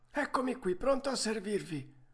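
vocina acuta da vecchio falegname; mastro falegname. Acted. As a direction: rispondi in tono molto acuto, da anziano artigiano. (vocetta alta del falegname)

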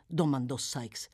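plain reading voice

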